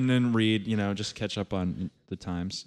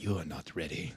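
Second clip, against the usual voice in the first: put-on wise old shopkeeper; 5 semitones lower, 10 dB quieter than usual. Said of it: "I just want him to be like".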